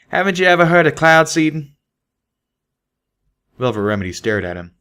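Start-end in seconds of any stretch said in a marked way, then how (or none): 0.1-1.8 nonchalant country stallion; Calamity voice. Acted. Calamity teasing Velvet.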